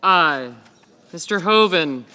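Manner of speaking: usual speaking voice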